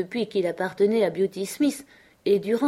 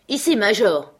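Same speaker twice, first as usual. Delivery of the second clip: louder, higher-pitched warning call; shouted command; 4 semitones higher, 7 dB louder than usual. A narrator's reading. Scott calls his dog away from the meat, shouting. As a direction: call the dog back urgently. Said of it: "cria Scott".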